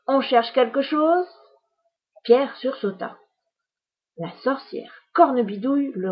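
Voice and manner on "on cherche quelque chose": loud, teasing witch voice; spring out and catch the boy; mocking witch catching a child; sly witch. Witch surprises Pierre.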